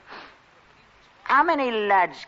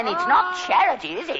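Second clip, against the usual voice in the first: loud; 12 semitones higher, 4 dB louder than usual. Acted. Mock-righteous shout about charity.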